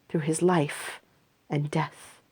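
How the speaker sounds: plain reading voice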